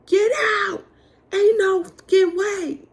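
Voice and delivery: high, loud furious yell. Retold or imitated. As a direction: yell it like you're throwing them out. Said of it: "I'm yelling".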